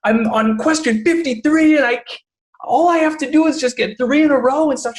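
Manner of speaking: put-on a high, distraught kid voice, close to tears; upset daughter near tears. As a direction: imitate a girl about to cry over her test. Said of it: "almost in the break of tears".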